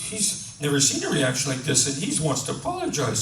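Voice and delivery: ordinary talk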